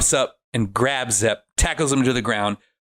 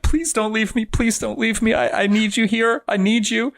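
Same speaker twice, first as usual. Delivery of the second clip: high, whiny mock crying; imitated tearful pleading; 10 semitones higher than usual. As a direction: beg in a high, put-on cry. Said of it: "And Adam is crying".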